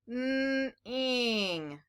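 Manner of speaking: "N" held as a hum, then "ing" on its own; sounding out. Shown as a demonstration. Teacher decoding the second syllable for children, sounding out. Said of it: "Let's do the second syllable".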